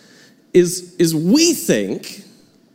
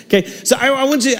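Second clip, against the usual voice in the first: ordinary delivery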